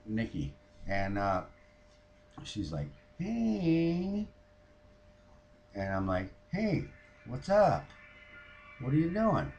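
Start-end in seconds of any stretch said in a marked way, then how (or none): 3.2-4.3 high, drawn-out hello; friend's phone greeting. Retold or imitated. 6.5-9.5 high-pitched friendly hello; retold phone greeting.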